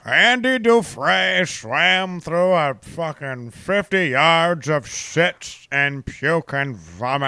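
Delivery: slow, measured narrator impression; deliberate, drawn-out storyteller voice; Morgan Freeman. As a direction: narrate it slowly, like a solemn film voiceover. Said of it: "walking around with Morgan Freeman's accent".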